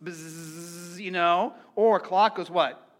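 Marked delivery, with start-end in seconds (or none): 0.0-1.0 soft imitated bee buzz. Shown as a demonstration. Pastor imitating a bee for the congregation, soft buzz. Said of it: "what does a bee do".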